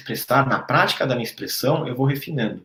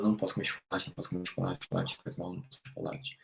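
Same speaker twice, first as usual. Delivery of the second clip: fading inner mantra; 7 semitones lower, 13 dB quieter, faster than usual. Retold or imitated. Repeating a dieting inner voice.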